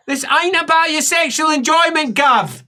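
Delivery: high, exasperated rebuke; scolding helper. Acted. Helper rebuking Gav, acted exasperated voice. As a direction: put the patient firmly in his place.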